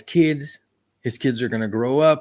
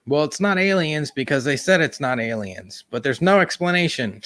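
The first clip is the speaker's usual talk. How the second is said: put-on high, nasal old-newsreel announcer; mock a 1950s newsman's reassuring read; nineteen fifties reporter voice; 4 semitones higher than usual. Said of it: "putting on that nineteen fifties, nasal reporter voice".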